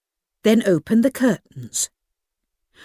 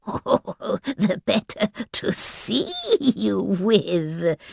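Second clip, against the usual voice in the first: chuckling; 4 dB quieter, slower than usual. Acted. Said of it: "the wolf chuckled".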